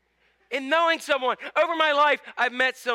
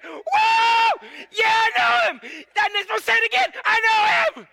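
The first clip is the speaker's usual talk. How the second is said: very high, loud whooping joy; ecstatic Paul; 12 semitones higher, 6 dB louder, slower than usual. Acted. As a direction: whoop it like he's thrilled. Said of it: "Paul's writing here is he's saying".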